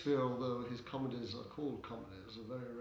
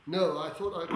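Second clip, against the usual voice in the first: ordinary talk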